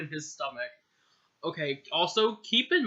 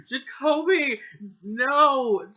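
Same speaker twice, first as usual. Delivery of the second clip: higher, louder cry of despair; anguished cry; 8 semitones higher, 7 dB louder, much slower than usual. Acted. Friend crying out as he collapses. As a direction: scream his name in horror.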